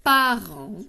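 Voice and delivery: PARENT drawn out and loud, stressing the en sound; emphasised example word. Shown as a demonstration. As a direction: hold the nasal ending of PARENT. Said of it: "comme dans".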